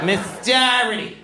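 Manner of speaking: high-pitched "Darrity" for Doherty; Boston mispronunciation. Retold or imitated. Teacher mispronouncing her own name, Doherty as high-pitched "Darrity". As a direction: call out Doherty as "DAH-ritty", pitched high. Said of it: "She mispronounced her own name".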